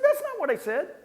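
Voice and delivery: shocked objection; indignant protest. Retold or imitated. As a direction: caller insisting he meant no sarcasm.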